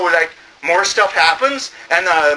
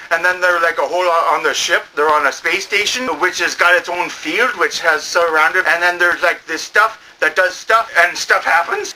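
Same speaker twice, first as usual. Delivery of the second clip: ordinary talk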